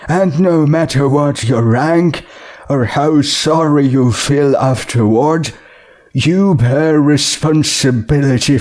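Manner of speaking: stern judge passing sentence. Acted. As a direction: lay down the law from the bench.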